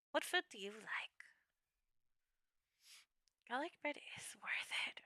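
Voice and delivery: soft breathy whisper. Acted. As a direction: whisper it like a secret. Whispered question and answer.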